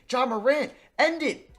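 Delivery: high, loud urging yell; real excitement, shouted at the screen; excited yell. Real, not acted. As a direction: beg the shot to go in, shouting. Last shot, urging his player.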